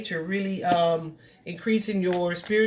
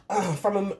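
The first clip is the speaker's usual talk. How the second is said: normal talking voice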